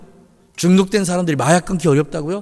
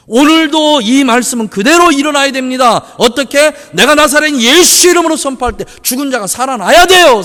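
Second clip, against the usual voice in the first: loud, high, driving sermon delivery; full-force preaching; 7 semitones higher, 8 dB louder than usual. Acted. Pastor to the congregation, full-force preaching. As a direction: preach it out with rising fervor.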